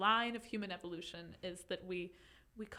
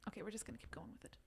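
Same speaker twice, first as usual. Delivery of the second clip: whispering; 11 dB quieter, much faster than usual. Real, not acted. Aside while reading.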